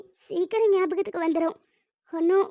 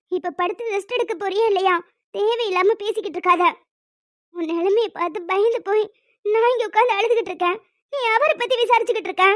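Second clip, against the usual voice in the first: louder, exasperated scolding; 3 dB louder than usual. Acted. Older sister scolding the injured girl to stop talking and rest, angry. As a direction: tell her off, fed up.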